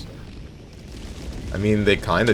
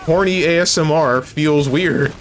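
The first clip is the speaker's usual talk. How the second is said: higher-pitched, defensive protest; indignant denial; 6 semitones higher than usual. Real, not acted. Streamer denying a chatter's claim about ASMR, defensive and high.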